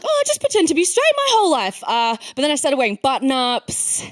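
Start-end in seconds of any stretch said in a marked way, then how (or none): 0.0-1.8 naive inner voice. Retold or imitated. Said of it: "I was like".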